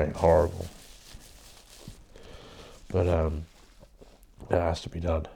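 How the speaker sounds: talking while blowing nose; blocked nose, muffled speech. Real, not acted. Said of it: "sorry about blowing my nose all the time".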